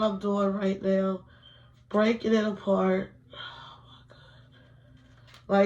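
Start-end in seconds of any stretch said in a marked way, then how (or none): 3.3-5.7 very slow, breathy whisper; stunned whisper. Real, not acted. Whispering in disbelief while she breaks the wax strip apart. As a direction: let it out as a drawn-out whisper.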